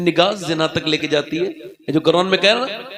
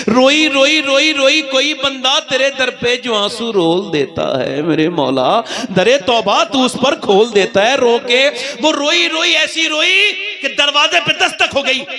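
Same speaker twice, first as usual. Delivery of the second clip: impassioned preaching; 5 semitones higher, 3 dB louder than usual. Acted. Preacher climaxes on her weeping.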